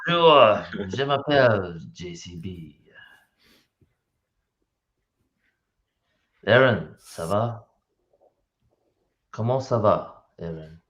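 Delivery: playful French spoken on request; flirty French greeting with pauses between phrases; French accent. Shown as a demonstration. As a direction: put on a suave French accent. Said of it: "with the French accent, please".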